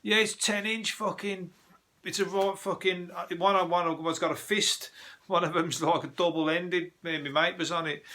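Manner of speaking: high-pitched mock shouting over music. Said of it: "they're fucking shouting".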